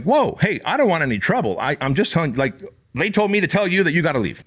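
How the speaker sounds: high, hurried backing-off voice; scared bouncer pleading with the drunk woman not to fight; nervous placating. Retold or imitated. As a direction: raise your hands and talk her down, rattled.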